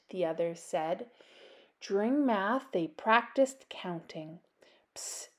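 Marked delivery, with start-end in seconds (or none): 5.0-5.3 quiet unvoiced psst; psst whisper. Ophelia whispering to Little Bat in class. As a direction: hiss for attention quietly. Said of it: "Ophelia whispered".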